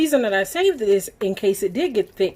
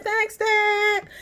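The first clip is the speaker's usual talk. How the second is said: her own reply; 13 semitones higher than usual. Retold or imitated. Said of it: "I'm like".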